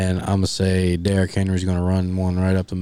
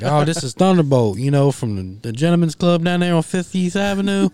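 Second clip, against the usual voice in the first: smooth prank caller explaining, pitched up; mimicking a caller; 9 semitones higher than usual. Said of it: "He's like".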